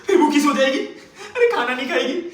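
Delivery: loud, very high-pitched wail of frustration; exasperated outburst. Acted. Man despairing she will sleep hungry, exasperated. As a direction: cry out in comic despair. Exasperated at girlfriend refusing food.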